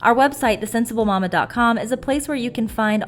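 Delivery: upbeat sponsor read, loud. Acted. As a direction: read it like a commercial.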